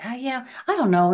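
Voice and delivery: put-on reluctant judgement; mock gossipy agreement. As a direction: go along with the gossip, doubtfully. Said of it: "you just get bitchy or gossipy or moany".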